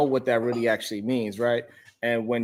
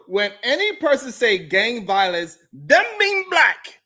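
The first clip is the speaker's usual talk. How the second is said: put-on voice; 9 semitones higher, slower than usual. Reading chat in voice.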